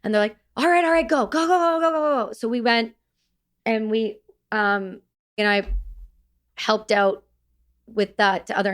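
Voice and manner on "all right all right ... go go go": high, urgent go go go; urgent go call. Retold or imitated. Unit waving him through, high and urgent. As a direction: hurry them out, pitched up.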